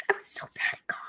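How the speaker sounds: choked, whispered through tears; real tears, whispered; crying whisper. Real, not acted. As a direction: barely get it out while crying. Recalling teen despair, crying.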